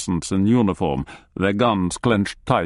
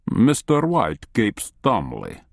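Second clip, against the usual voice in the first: ordinary delivery, nothing marked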